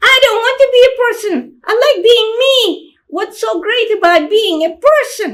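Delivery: put-on a defiant small child; little girl's voice. Little sister protesting she won't be a person, high child voice. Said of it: "make it sound like a children's voice".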